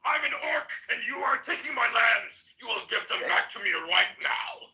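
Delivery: high, forced orc war cry; Warcraft orc. Acted. Orc warrior to land thieves, high strained orc roar. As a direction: bellow it like an orc claiming back land. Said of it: "the voices of the orcs from World of Warcraft".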